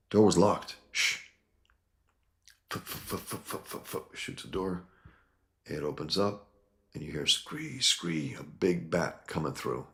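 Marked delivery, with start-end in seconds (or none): 2.7-3.9 breathy f sounds for a silenced gun; whispered gun noises. Acted. Acting out the comic's gunfire as hushed puffs. 7.3-8.3 bat screech. Acted. Voicing the bat's screech while retelling the panel.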